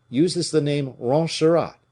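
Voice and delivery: usual speaking voice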